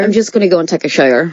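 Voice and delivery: Northern Irish accent, shower as "shoyer"; Northern Irish demo. Shown as a demonstration. Guest demonstrating a practice phrase, Northern Irish accent. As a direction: show off the Northern Irish vowels. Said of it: "shower's a good one".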